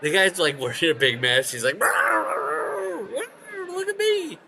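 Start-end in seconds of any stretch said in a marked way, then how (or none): 1.8-4.4 much higher, showy put-on voice; mock show-off. Masked guy showing himself off, high mock voice. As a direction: squeal it like a preening poser.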